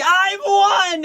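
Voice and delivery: hammed-up villain triumph; mock triumph. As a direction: crow it like a gloating villain who thinks he's won. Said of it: "Mayuri standing over it like".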